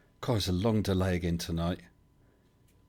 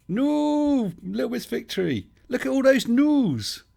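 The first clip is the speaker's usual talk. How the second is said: character voice; 5 dB louder, slower than usual. Acted. Streamer voicing his character Max.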